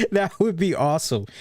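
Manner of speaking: normal talking voice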